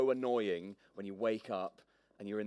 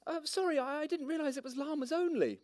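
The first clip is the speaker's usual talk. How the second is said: put-on flustered girl voice. Maya apologizing in a story.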